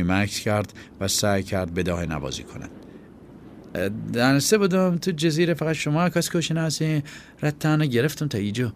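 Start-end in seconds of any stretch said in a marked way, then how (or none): 4.1-8.7 put-on high, hesitant boy's voice bluffing; a scared kid trying to sound tough; nervous boy. Chaghak improvising.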